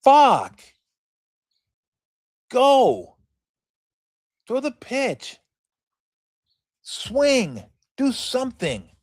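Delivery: frustrated fan at home yelling at a slow at-bat; impatient fan yelling. Retold or imitated. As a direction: yell at the batter to just get on with it. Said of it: "we're all sitting there at home like".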